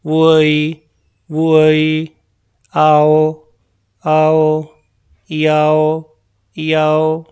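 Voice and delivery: "आई, वाई, ओई, वोई, आओ, याओ" loud and separate, each one twice. Shown as a demonstration. Teacher loudly modelling "ओई" and "याओ" for repetition. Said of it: "और आई को पढ़ा था".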